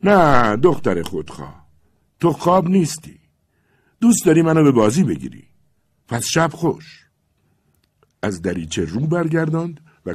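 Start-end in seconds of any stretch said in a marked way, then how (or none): 0.0-7.1 hurt, loud and higher; loud wounded reproach. Acted. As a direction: shout up at her, stung and proud. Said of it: "با صدایی بسیار بلند گفت".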